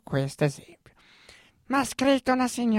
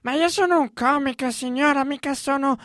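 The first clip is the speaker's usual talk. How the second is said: higher and louder indignant reply; 4 semitones higher, 5 dB louder than usual. Retold or imitated. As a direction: protest loudly that you're no doctor. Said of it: "Io ho detto".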